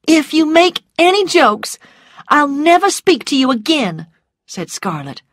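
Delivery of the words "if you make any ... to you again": high, loud, upset warning; frightened indignation, pitched high; shaking voice. A narrator's reading. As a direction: threaten him while badly rattled. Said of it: "her voice shaking".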